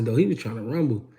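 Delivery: usual speaking voice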